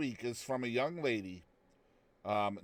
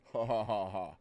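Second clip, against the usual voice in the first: amused laugh; 4 semitones lower than usual. Real, not acted. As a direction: laugh at your own slip. Laughs at own mix-up.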